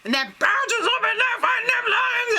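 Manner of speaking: high, hammed-up shouting with laughter; manic mock contestant. Imitating dentist's badger fighting lions, manic shouting.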